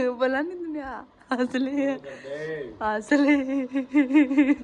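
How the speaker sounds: real laughter breaking into the words; laughing. Real, not acted. Wife telling husband to go on laughing, laughing herself. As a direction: tease through giggles, stretching the last words. Laughing through a playful quarrel.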